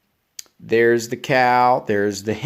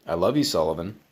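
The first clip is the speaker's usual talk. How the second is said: tender murmur; 5 semitones lower, 4 dB quieter, much faster than usual. Real, not acted. Parent tucking child in.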